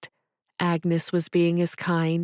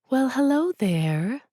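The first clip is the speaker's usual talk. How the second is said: put-on a light French accent, pitched higher in welcome; 7 semitones higher than usual. Agnes welcoming customers into her hat shop, a light French accent. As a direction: greet them the way a French shopkeeper would. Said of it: "Agnes said in her French accent".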